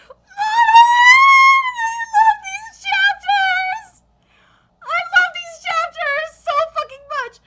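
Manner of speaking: very high, loud, drawn-out squeal; overwhelmed fan wail. Real, not acted. Fan overcome by these chapters, high emotional wail. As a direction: wail your love for it, dragging it out.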